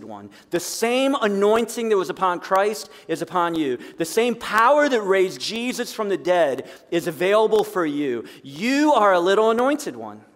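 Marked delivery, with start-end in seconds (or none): none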